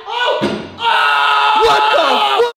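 high, loud shocked yelling; shocked shouting. Real, not acted. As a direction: yell in disbelief. Shocked by trick shot.

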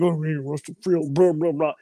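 mock the people who mock us; scoffing at fans who tease about rushing the field; mocking rival.

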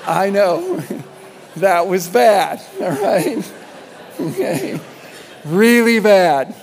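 high, loud laughing through the words; giggling real embarrassed laughter; laughing at himself. Real, not acted. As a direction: crack up at your own bad joke. Laughing at own bad joke.

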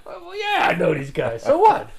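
laughing, high and cocky; defiant shrug. Retold or imitated. Telling the judge he knows the defendants, cocky and laughing. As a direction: toss it off with a laugh.

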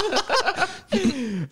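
giggling burst; high laugh. Real, not acted. Apologising, laughing.

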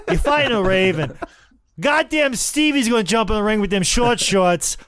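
a hammed-up, rowdy Revere local in a Boston accent; shouting Boston fan hyping Sandman and Tommy. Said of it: "Boston accent".

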